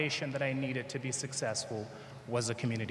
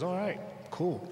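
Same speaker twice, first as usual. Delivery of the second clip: lightly voiced driver, pitched higher; a hint of a casual Uber driver; imitating the driver; 6 semitones higher than usual. Uber driver shrugging off his answer, mildly voiced higher. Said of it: "He says".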